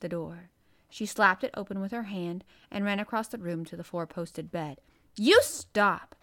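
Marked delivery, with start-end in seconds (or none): 5.1-6.1 loud, furious command; almost shouting. A narrator's reading. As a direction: order it just short of a shout. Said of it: "she almost shouted".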